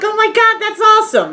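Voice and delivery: put-on very high gushing girl; squealy put-on delight; mock excitement. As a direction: imitate a thrilled friend, way up high. Mimicking friend's delighted reaction.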